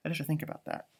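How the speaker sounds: whispered thought to self; whispered aside. Real, not acted. Reader musing in a whisper about parks with fairies. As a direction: whisper it to yourself.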